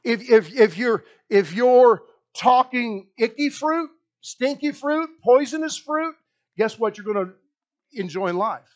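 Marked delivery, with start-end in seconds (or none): none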